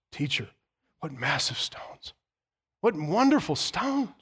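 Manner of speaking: marvelling voice of awed disciples; a touch of wide-eyed disciples admiring the temple. Acted. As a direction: gasp at the stones in wonder.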